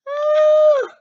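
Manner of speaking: high, loud frightened shriek; scream. Acted. Mitzi and Murphy scared by the monster story, screaming. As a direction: shriek in terror. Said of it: "both screamed".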